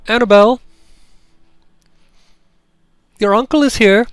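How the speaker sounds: put-on high, loud woman calling a child across the yard; strong clear call. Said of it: "a woman's voice, strong and clear".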